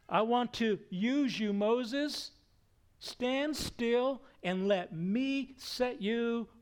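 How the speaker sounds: high, loud, deliberate summons; God commanding Moses. Acted. God calls Moses to stand still, commanding summons.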